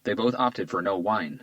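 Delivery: ordinary delivery, nothing marked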